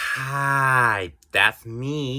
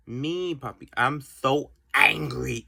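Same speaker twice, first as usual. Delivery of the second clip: ordinary talk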